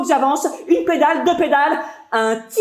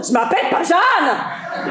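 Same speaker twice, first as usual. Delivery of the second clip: je m'appelle pas Jeanne yelled back, slower, half laughing; shouted protest; 4 semitones higher than usual. Acted. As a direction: shout it over her shoulder, laughing. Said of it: "Je me retourne".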